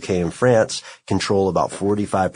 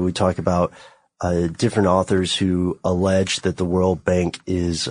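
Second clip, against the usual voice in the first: ordinary talk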